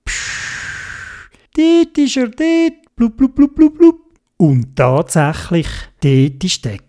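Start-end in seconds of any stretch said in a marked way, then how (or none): 0.0-1.3 long voiceless diving-gear hiss; imitated breathing hiss. A narrator's reading. Theobald about to speak underwater, imitated airy hiss. 1.5-3.0 high-pitched figure voice; Theobald's underwater voice. A narrator's reading. Theobald spotting the lost squid in the distance, high excited voice. 3.0-4.0 quick high "blub" bubbles; imitated bubbles. A narrator's reading. Theobald finishing his underwater speech, high bubbling noises.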